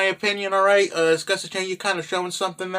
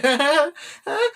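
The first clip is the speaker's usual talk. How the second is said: high laugh; 9 semitones higher than usual. Real, not acted. Laughing at own story joke.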